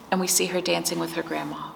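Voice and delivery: usual speaking voice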